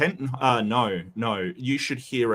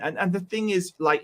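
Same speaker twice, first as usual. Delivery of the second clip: ordinary talk, nothing marked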